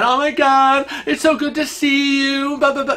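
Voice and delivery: high, effusive chatter; gushing lady. Acted. Lady going on and on at a near stranger, gushing. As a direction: gush on and on in a high voice. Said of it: "going on and on".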